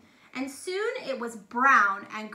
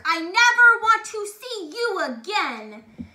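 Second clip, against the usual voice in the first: loud, higher furious shout; angry shout; 6 semitones higher, 9 dB louder than usual. A narrator's reading. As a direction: throw him out. Duke banishes baker.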